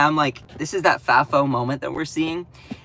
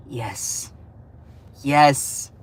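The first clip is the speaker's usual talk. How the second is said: ordinary talk, nothing marked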